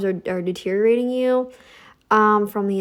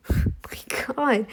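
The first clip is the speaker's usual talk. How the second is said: laughing whisper; 2 semitones higher than usual. Real, not acted. Laughing whisper after comment.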